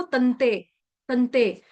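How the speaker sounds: तंते with a half न, not तनते; slow, तंते, the न closed and nasal. Shown as a demonstration. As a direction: say तंते with no vowel after the न. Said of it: "ये तनते नहीं बोलेंगे".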